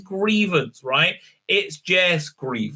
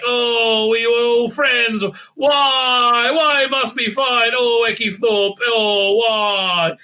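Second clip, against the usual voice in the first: hammed-up anguished pleading, higher, with a cry on Why; mock melodramatic lament; 6 semitones higher, 3 dB louder, much slower than usual. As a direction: wail it like a soap-opera hero. Mock hero lamenting the fight.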